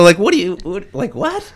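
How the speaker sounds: high-pitched, stammering astonishment; retold disbelief. Fan hearing a stranger's claim, acted incredulous. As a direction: replay being baffled, jumping up high on the last word.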